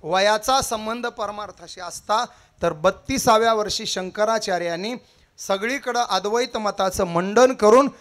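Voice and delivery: loud rising kirtan oratory, each line hammered out; booming devotional sermon cadence; preaching at full force. Acted. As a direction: preach it to the crowd, building every round.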